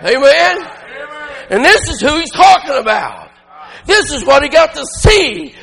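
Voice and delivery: ordinary talk, nothing marked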